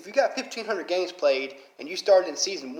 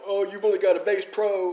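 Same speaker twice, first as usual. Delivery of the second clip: put-on higher, snotty kid taunt; a lightly voiced, higher mocking kid; mocking little kid; 5 semitones higher than usual. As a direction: mimic a bratty kid sneering. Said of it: "hear these little kids talking".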